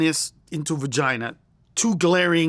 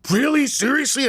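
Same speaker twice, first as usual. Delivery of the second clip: mock crowd shock, rising higher; freaked out; 4 semitones higher, 3 dB louder, slower than usual. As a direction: imitate a startled audience reacting in disbelief. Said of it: "And they'd freak out".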